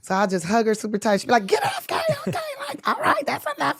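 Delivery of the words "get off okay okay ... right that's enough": mom's voice pitched way up, brushing her off; high mom voice. Retold or imitated. Imitating mom telling her clingy daughter to get off. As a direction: squeak out a mock mom shrugging off a hug.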